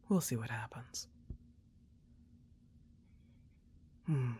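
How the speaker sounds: put-on hushed, intimate girlfriend whisper close to the mic; soft ASMR whisper. Girlfriend soothing her partner during movie night, soft intimate whisper. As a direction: murmur it softly as if cuddled up close.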